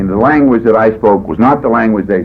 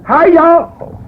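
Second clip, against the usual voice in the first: high, loud Okie "Hi, y'all!" with a long drawn "y'all"; hammed-up Okie speaker greeting the meeting; 3 dB louder than usual. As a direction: greet the room in a big Okie drawl. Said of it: "He had that Okie accent".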